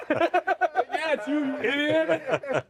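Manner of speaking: high exasperated jab through laughter; mock exasperation, breaking into laughs; fed up. Jesus answers Judas at dinner, laughingly fed up. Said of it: "he was so fed up".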